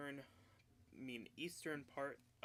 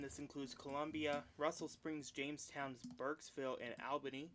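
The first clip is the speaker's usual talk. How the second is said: ordinary talk, nothing marked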